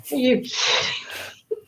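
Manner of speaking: hushed, drawn-out incredulity; slow, barely voiced mock disbelief; retold disbelief. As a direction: half-whisper it in stunned disbelief. Retold disbelief at redo demand.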